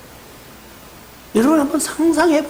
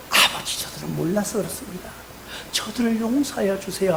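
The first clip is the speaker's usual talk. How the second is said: hushed, much softer plea; soft prayer; 4 semitones lower, 8 dB quieter than usual. Retold or imitated. Jesus on the cross praying for his killers, hushed. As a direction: pray barely above a breath.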